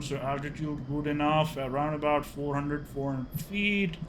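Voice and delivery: ordinary talk, nothing marked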